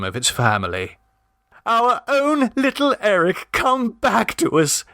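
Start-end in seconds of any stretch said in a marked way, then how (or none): 1.6-4.8 high, tearful cry of a doting parent; crying out with relief, pitched high; cried out. A narrator's reading. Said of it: "cried the Mummabees in unison".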